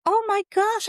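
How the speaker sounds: very high-pitched re-enacted cry of recognition; excited yell. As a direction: act out yelling in amazed delight. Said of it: "I just let out a yell".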